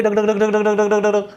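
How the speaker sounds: even rapid dhak dhak, same beat each time; machine gun imitation. Shown as a demonstration. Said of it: "एक जैसी आवाज़ होती है". (Machine gun जैसी एक समान धक धक आवाज़)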